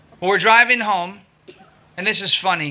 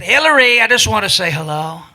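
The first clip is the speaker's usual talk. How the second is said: taunting impression, hailing someone over a bullhorn; Donald Trump; 3 dB louder than usual. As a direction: call out the name big, then settle into a smug greeting. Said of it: "I took my bullhorn out".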